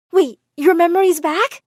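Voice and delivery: high, loud, astonished question; shocked. A narrator's reading. Amara learning his memory has returned, shocked. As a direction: blurt it out in disbelief. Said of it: "I looked at him in shock".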